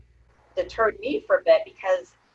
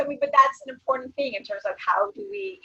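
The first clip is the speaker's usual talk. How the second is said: ordinary delivery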